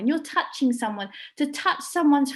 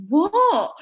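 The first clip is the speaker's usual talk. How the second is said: higher, louder startled what; retold shocked what; 8 semitones higher, 7 dB louder than usual. Telling how her sister burst into tears, shocked. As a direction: react in disbelief. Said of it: "I was like".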